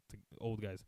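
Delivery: ordinary talk, nothing marked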